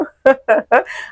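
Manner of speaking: high little giggle; light laugh. Real, not acted. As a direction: laugh it off after the plug. Laughs after her channel plug.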